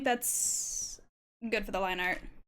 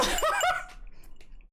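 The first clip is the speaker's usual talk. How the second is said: breathy high laugh. Real, not acted. Laugh after "real.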